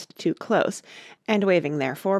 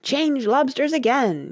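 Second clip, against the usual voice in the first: high, loud command; excited yell; 8 semitones higher, 5 dB louder than usual. A narrator's reading. Gryphon calling the next figure, yelling. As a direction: yell out the dance call. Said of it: "yelled the Gryphon at the top of its voice".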